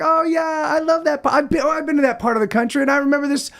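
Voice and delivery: high, fast, bright-eyed mock remembering; mock cheerful recollection. Rambling about a place, he mocks his cheerful self. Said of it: "and I'm like".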